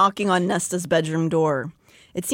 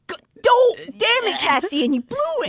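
much higher cracking self-reproach; self-scolding Cassian; 12 semitones higher than usual. Retold or imitated. Cassian cursing himself for blowing it, in a high strangled voice. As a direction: scold yourself in a strangled squeak. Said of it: "Cassian's like".

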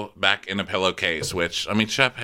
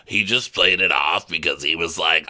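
ordinary talk

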